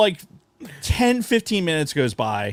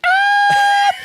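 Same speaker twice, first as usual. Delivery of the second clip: high shrill yelp of fright, loud; high startled scream; retold scream; 8 dB louder than usual. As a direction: shriek like a bat just flew at your face. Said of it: "fucking screamed".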